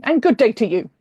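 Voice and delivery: loud, curt farewell; curt loud dismissal. Acted. William dismissing the woman curtly and loudly before marching off. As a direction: slam the door on her with words.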